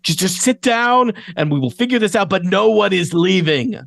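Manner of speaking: high, hurried curator voice; flustered curator. Acted. Museum curator orders panicking guests to stay, high and urgent. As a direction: take charge of a crowd in a high, urgent voice. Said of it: "and he says".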